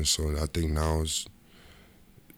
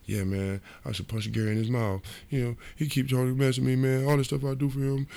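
whining; 8 semitones higher than usual. Retold or imitated. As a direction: complain more and more like a hurt kid. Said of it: "I'm whining".

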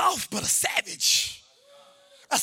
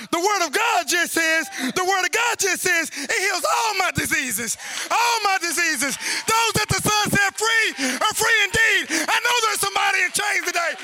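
building to a high shouted climax; full-force preaching; 6 semitones higher, 4 dB louder than usual. Real, not acted. Preacher calling out to somebody in chains, full-force preaching. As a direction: pile up the phrases, ever higher.

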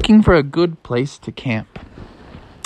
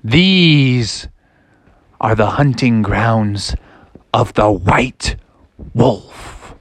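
slow, lower, portentous warning; ominous dad; 3 semitones lower, much slower than usual. Acted. Dad reveals whose hunting grounds these are, ominous. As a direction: reveal it slowly with dramatic pauses. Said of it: "And he's like".

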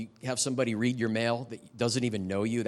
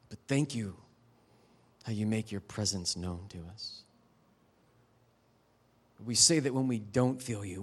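closing prayer; 3 semitones lower than usual. Acted. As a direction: pray it over the room, unhurried. Pastor leads closing prayer.

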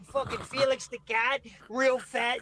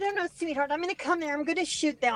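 higher, put-on woman's voice, sugary with a threat underneath; cat lady prank voice; 7 semitones higher, faster than usual. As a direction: play the fake-sweet neighbour lady on a prank call. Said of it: "for you to do the cat lady".

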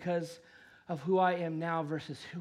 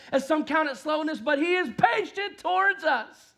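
rising, louder, higher preaching; 9 semitones higher, 7 dB louder than usual. Acted. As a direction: build to a full-force sermon climax. Preaching builds to full force.

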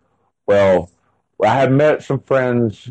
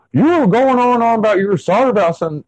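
much higher, tumbling out in disbelief; rattled explaining; 13 semitones higher, faster than usual. Retold or imitated. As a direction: tell her what she said, alarmed. Husband explaining to wife.

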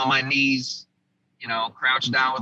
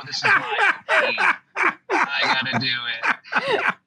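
hammed-up heroic shout. The guys mocking the sergeant's brave line, hammed-up heroic shout. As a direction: belt it out like a cartoon hero, teasing. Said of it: "They make fun of me to this day".

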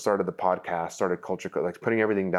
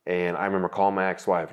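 normal talking voice

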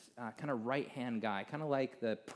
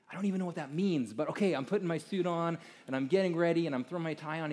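higher and louder, quick and flustered; flustered rush; 5 semitones higher, 5 dB louder than usual. Retold or imitated. To Bob while hurrying into his suit, flustered and rushed. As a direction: gabble it out, caught off guard.

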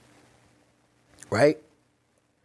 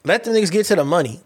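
higher, fast, brash dismissal; brash friend; 6 semitones higher, faster than usual. Retold or imitated. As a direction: bark it off fast and high. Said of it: "D W will be like".

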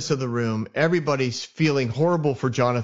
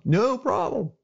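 a much higher put-on voice for the producer; Bob's voice; 10 semitones higher, slower than usual. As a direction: answer as Bob in a silly high voice. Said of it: "Thank you for that commercial break, Bob".

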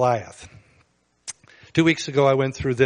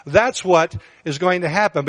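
normal talking voice

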